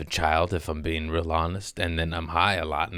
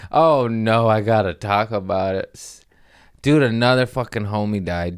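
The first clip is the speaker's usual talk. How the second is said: ordinary talk, nothing marked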